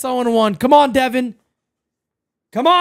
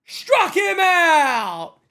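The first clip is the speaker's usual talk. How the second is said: long, drawn-out triumphant call; shouted strikeout call; much slower than usual. Real, not acted. Streamer celebrates the strikeout, slow shouted call. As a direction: stretch it out like a play-by-play announcer.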